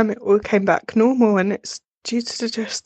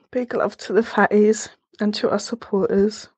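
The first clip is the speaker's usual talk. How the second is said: normal talking voice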